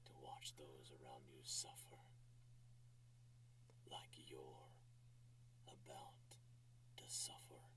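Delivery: breathy dramatic whisper. Acted. Reading a dramatic line softly.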